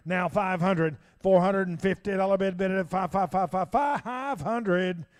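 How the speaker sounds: loud rapid auction chant; auctioneer. Acted. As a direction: rattle off bids, building to sold. Auctioneer bid calling.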